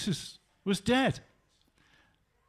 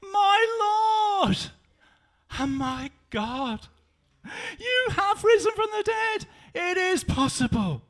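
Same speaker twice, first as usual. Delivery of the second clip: much higher, crying out; ecstatic cry; 12 semitones higher, 3 dB louder than usual. Acted. Tom on his knees before Jesus, ecstatic cry. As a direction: cry out in awed worship. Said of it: "he got on his knees".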